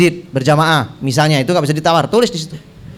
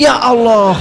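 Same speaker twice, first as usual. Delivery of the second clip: high, comically shocked exclamation; acted shock, pitched up; mock dismay; 6 semitones higher, 3 dB louder, slower than usual. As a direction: cry out in playful disbelief. Mock shock at four children.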